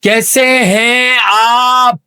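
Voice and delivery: कैसे हैं आप with each word greatly elongated; very slow repeat. Acted. Interviewer repeats for deaf guest, कैसे हैं आप hugely drawn out.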